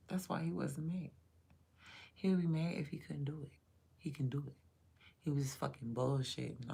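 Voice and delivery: ordinary delivery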